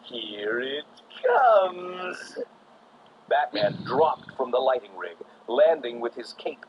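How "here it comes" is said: slow, loud, grinning menace; grinning Joker. A narrator's reading. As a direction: drag it out with a wicked grin. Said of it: "he said with a grin".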